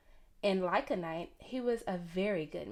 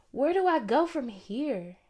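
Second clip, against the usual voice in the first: pleading preacher; 5 semitones higher, 5 dB louder than usual. A narrator's reading. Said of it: "he asked over and over".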